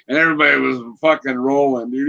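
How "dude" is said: ordinary delivery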